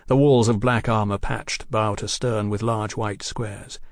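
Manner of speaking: usual speaking voice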